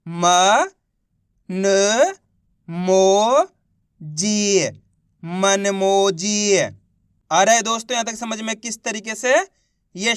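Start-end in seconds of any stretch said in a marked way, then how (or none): 0.1-4.7 म, न, मो, जी each held long and set apart; spelling out syllables. Shown as a demonstration. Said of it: "एक एक अक्षर का उच्चारण सही से". 5.2-6.6 very drawn-out model word; slow blended reading. Shown as a demonstration. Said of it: "इनको आपस में मिला के पढ़ना है".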